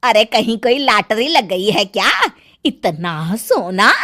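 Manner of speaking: amazed aunt. Acted. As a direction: marvel greedily at the gold. Kaki sees the gold.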